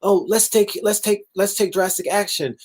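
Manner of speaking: high, fussy mock panic; hammed-up scandalized officials demanding action; mock panicked officials. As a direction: lift the voice and ham up the alarm. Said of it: "The reaction is".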